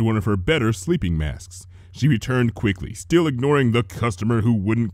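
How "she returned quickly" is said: plain reading voice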